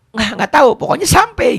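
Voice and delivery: high, loud brush-off; flustered know-nothing reply. Acted. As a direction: blurt it out high, as someone who has no idea. Voices the woman who can't explain.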